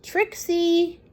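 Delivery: plain reading voice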